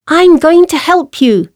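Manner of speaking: high, determined mouse voice; little mouse. A narrator's reading. Mouse to trapped lion, high determined voice. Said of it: "said the mouse".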